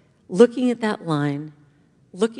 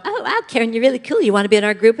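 higher, loud mock gushing; fawning cool girls; 3 semitones higher, 8 dB louder, much faster than usual. Cool girls inviting her into their group, mock fawning. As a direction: gush like an impressed schoolgirl.

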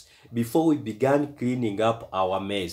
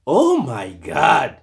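shocked; 5 semitones higher, 8 dB louder, slower than usual. A narrator's reading. Dad shocked by boys' counterfeiting.

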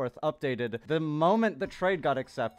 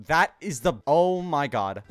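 ordinary talk

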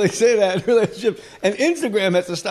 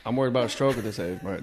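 lightly imitated worrier, low and quick; mimicking the guest; 7 semitones lower, 6 dB quieter, faster than usual. Host echoing guest's stroke worry, low mocking imitation. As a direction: toss it back low and fast in a hint of his voice. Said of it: "This is what you sound like".

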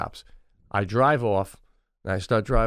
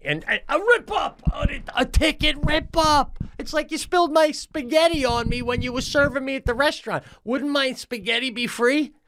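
much higher shouted rant, slowing on the punchline; outraged rant; 13 semitones higher than usual. Real, not acted. Venting about the cop's joke to his cohost, shouted high.

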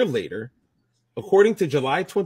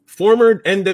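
wrestling announcer; 9 semitones higher, 10 dB louder than usual. Acted. Loud slip-up into wrestling voice.

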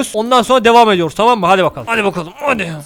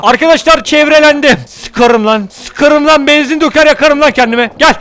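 loud, higher defiant threats; defiant shout; 5 semitones higher, 4 dB louder than usual. Real, not acted. Surrounded by wolves, shouting defiant threats.

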